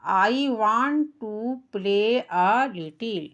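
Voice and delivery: each English word drawn out very slowly; slow pronunciation demo. Shown as a demonstration. Teacher showing pupils the pronunciation, very slow. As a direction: model every word slowly and clearly. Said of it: "उच्चारण देखो".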